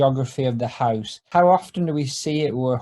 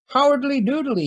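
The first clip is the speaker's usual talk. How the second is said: high, drowsy neighbourly Ned voice; high sleepy Flanders greeting; 11 semitones higher than usual. Acted. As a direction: answer the phone half-asleep, high and chirpy. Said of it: "he says sleepily".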